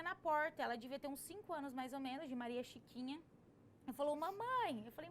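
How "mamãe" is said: put-on child voice. Little girl in dream calls.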